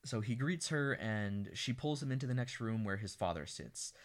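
ordinary talk, nothing marked

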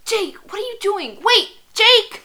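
high, loud panicked-girl impression; Bella. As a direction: act out a frantic heroine calling after him. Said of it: "Do it in Bella's, like, voice".

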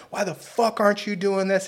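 high, yelling coach tirade; a coach tearing into a sixteen-year-old, high and yelling; cussing out. Retold or imitated. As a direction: chew the kid out like an angry coach. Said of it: "he's just cussing me out".

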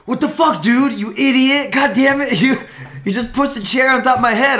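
high, loud furious yelling; seething with anger. Retold or imitated. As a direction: yell at him like he dropped a chair on your head. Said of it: "just seething with anger".

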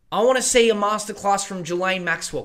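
ordinary delivery

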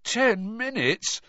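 slow, high croak of dismay; drawn-out shocked croak; croaked disbelief. A narrator's reading. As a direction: croak it slowly, stunned. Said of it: "Harry croaked".

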